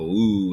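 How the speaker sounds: "Ooh" stretched long and drawled. Retold or imitated.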